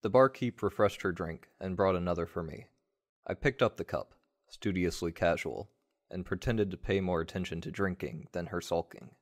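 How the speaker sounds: usual speaking voice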